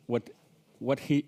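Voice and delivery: normal talking voice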